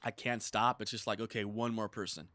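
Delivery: ordinary talk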